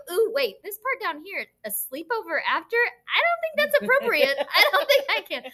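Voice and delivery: high, playful pretend hesitation; mock concern. Teacher teasing a kid about the sleepover, mock-scandalized. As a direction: build to a high-pitched feigned objection.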